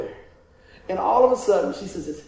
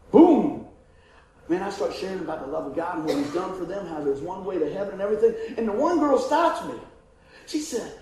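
usual speaking voice

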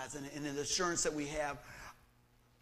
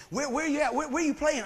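higher and louder, eagerly pressing with questions; eager friends; 9 semitones higher, 8 dB louder than usual. Retold or imitated. Friends eagerly asking where he plays, higher and louder. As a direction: jump in fast, hungry for the answer.

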